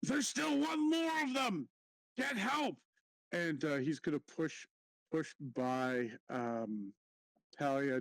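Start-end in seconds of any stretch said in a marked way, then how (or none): 0.0-2.8 high-pitched shouted alarm; in-character shout. Acted. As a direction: holler for help as a panicked character. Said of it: "uh, shout".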